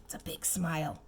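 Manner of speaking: soft whispered aside. Real, not acted. Aside to child about picture.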